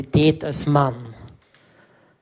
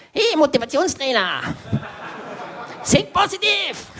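much higher, shouted mock cheer; teasing friend; 9 semitones higher than usual. Friend poking his grumpy mate, high mock shout. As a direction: ham up a pushy buddy yelling at you. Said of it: "dann kommt mein bester Freund und tut so bei mir beim Bauch".

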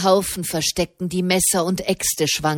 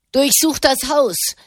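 high, raised angry command; furious bellow; 6 semitones higher, 3 dB louder than usual. A narrator's reading. Mob leader ordering his men to search, furious bellow. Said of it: "gröhlte eine wütende Stimme".

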